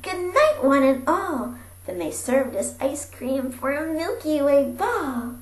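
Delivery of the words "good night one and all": high, loud joyful call; cried out. Acted. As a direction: cry it out to all the cows. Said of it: "I cried out".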